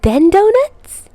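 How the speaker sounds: put-on high, slow, eager child voice; little Morgan bargaining for donuts, high and hopeful; hopeful little girl. A narrator's reading. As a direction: ask with shining eyes. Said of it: "Morgan's eyes sparkle".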